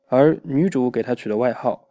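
ordinary delivery

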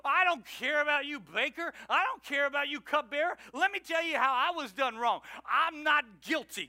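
loud, high, aggrieved complaining; a wronged prisoner snapping at the baker and cupbearer, loud; bitter protest. Retold or imitated. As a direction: insist you were wronged, indignant. Joseph voiced bitter, complaining.

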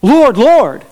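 "Lord, Lord" high on both words; emphatic, emotional. Retold or imitated. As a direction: voice each "Lord" as an impassioned profession. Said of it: "just emphatically say and emotionally say".

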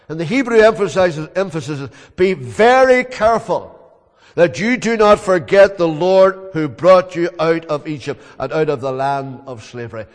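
loud, ringing sermon voice; preaching at full force. Acted. Preacher voicing Paul's testimony, loud and fervent.

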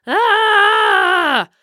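loud mock battle cry; requested shout. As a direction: belt out a big dramatic yell. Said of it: "what he asked for in his shout out".

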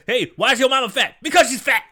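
high, loud hack-comic setup and punchline; shouted joke delivery. Retold or imitated. Comedian tells his material, loud high shout. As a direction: belt out the joke like an overeager comic.

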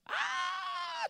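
a high squealing cry of joy; kids' excited shriek. Retold or imitated. Father voicing his small kids greeting him with an excited shriek. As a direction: shriek like little kids at the door.

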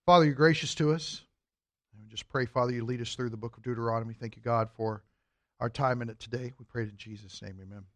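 soft, hushed opening prayer. Real, not acted. Teacher opening Sunday school with a prayer, hushed. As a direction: pray quietly over the class.

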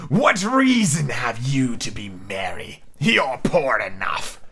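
slow, drawn-out disdain; Snape. Acted. Scrooge sneering at his poor nephew, Snape-like slow drawl. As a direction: stretch each word out with cold contempt. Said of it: "I should do Snape's voice".

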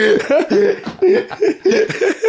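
long high laugh; big laugh. Real, not acted. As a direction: burst out laughing. Laughing after something tasty.

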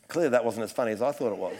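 ordinary talk

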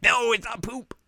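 high flustered denial; flustered protest. Retold or imitated. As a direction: deny it in a fluster. Sumire protests her disguise.

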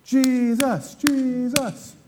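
the hometown crowd cheering for Jesus; mock crowd cheering. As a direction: cheer him on like a hometown fan. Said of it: "they're all like".

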